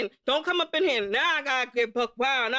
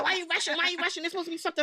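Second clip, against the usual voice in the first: a higher, whiny "why you rushing"; whiny attitude; 4 semitones higher, 3 dB quieter, much faster than usual. Retold or imitated. Imitating Lil complaining about leaving early, whiny. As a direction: mimic her annoyed friend. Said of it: "She's like".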